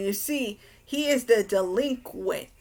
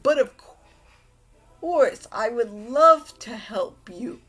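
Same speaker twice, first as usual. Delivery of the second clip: really excited; 2 semitones higher, 4 dB louder than usual. Retold or imitated. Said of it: "he is really excited".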